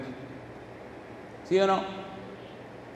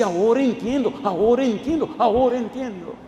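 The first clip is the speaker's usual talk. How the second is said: higher-pitched mock-weeping realization; imitated tearful cry; 4 semitones higher than usual. Preacher retells a convert's tearful realization, mock weeping. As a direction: repeat it in a wavering, pretend-crying rise. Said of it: "vino llorando y me dice".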